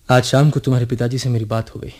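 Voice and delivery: ordinary talk, nothing marked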